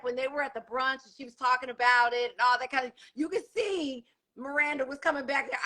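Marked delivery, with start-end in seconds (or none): none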